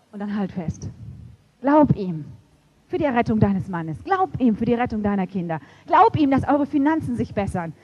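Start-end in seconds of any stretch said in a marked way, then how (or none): 1.6-7.7 rising to a loud, pleading climax; preacher building to the congregation, growing loud; building preaching. Acted. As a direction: hammer each Glaub harder.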